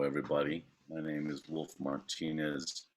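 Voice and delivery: ordinary talk, nothing marked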